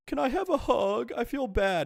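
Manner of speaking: put-on high, pleading little-boy voice; whiny sick kid. Sick boy begging grandma for a hug, high and whiny. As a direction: beg for a hug like a sick child. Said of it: "I was such a baby".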